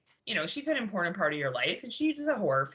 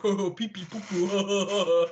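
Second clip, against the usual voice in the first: hammed-up silly babble; imitating a goofy podcaster; 5 dB louder, much slower than usual. Co-host mimicking Tim being ridiculous, silly mock voice. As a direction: play a silly guy, breaking into laughter. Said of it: "'cause he's like".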